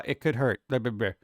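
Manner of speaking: normal talking voice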